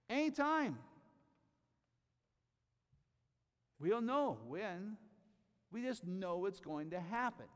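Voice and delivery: high, loud, ringing proclamation; full-force preaching. Acted. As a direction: preach it out to the whole room. Reads scripture, then climaxes.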